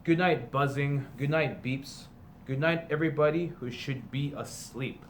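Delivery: slow, sing-song picture book read-aloud; storybook reading. A narrator's reading. Teacher reading a parody picture book to students, storybook voice. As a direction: read it like a bedtime story to the class.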